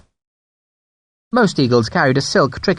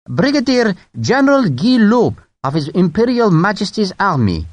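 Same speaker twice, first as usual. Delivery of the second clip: French brigadier; 7 semitones higher than usual. Acted. Brigadier's title.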